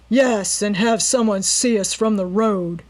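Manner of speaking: mock the man's stupid idea; sneering. A narrator's reading. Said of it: "sneered the leader".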